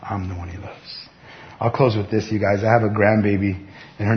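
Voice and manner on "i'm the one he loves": softer, slow and tender; hushed voice of the beloved; quiet confession. Pretend. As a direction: murmur it like a secret treasure. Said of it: "You say".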